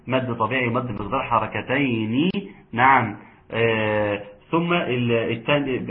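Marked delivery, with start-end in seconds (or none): none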